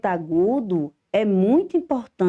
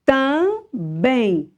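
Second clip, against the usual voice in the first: Também in two drawn-out syllables, strong BEM; teacher's slow demo of Também; stress on BEM; 5 semitones higher than usual. Shown as a demonstration. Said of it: "Bem é a sílaba mais forte".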